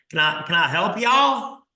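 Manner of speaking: hammed-up Texas accent. Garage sale seller greeting a browsing buyer, in a Texas accent. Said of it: "I do that horrible Texas accent".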